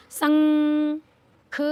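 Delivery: शंख with a loud, long held nasal hum, ख set apart; drawn-out anusvara. Shown as a demonstration. Teacher showing what the dot does, drawn-out anusvara. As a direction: hold the nasal in शंख very slowly. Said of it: "इसका उच्चारण क्या हो गया".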